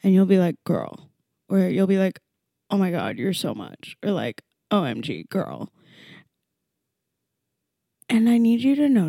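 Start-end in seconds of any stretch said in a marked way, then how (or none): 0.6-1.1 put-on low, dropping judgmental "girl"; deep, unimpressed mock reproach; disapproving mimicry. Mimicking people who judge her. 2.7-5.7 low, unimpressed mock judgment; deep mock-weary put-down; disapproving mimicry. Mimicking people who judge her.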